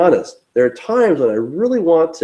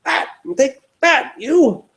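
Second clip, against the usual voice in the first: high-pitched hammed-up hostility; mock attack; 11 semitones higher than usual. Chasing Jehovah's Witnesses from the door, mock angry. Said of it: "getting your stones out and saying".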